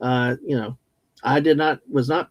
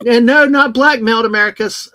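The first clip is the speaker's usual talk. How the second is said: playful loud protest; 6 semitones higher, 9 dB louder than usual. Pretend. Mock protest about blackmail.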